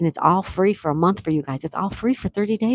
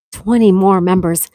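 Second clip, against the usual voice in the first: much louder, thrilled announcement; excited announcement; 7 dB louder than usual. Real, not acted. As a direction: shout the news with delight. Reacting to gifted memberships.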